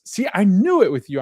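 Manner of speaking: high, loud triumphant gotcha. Retold or imitated. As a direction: catch him out, pleased with yourself. Girlfriend catching his sidestep.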